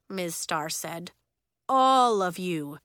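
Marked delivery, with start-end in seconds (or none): 1.7-2.8 firm. Acted. Teacher firm.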